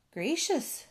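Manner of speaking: high-pitched mild surprise; exclaiming. A narrator's reading. Bullfrog hearing of the rescue, exclaiming. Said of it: "Jubal exclaimed".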